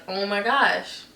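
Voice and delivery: mock panic, pitch rising through it; inner freak-out. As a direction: act out a mild inner meltdown as the voice climbs. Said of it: "I'm literally dying on the inside".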